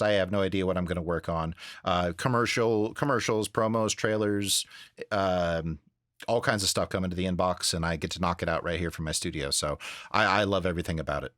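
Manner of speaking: ordinary talk, nothing marked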